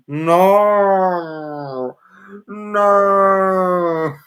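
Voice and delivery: loud, high drawn-out no; mock-horrified cry. Real, not acted. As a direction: wail it in comic dismay.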